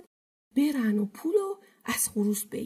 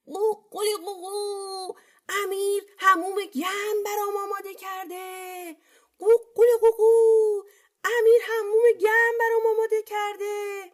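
defiant rooster; 10 semitones higher than usual. A narrator's reading. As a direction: crow on, refusing to give up. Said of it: "خروس بازم دست بردار نبود". (آواز زیر و سمج خروس)

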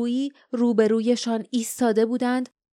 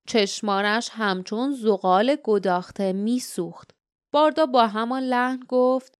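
usual speaking voice

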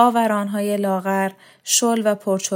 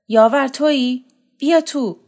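higher, louder call through the door; 6 semitones higher, 6 dB louder than usual. A narrator's reading. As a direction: shout for him to come in. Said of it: "بانوی پیر داد زد".

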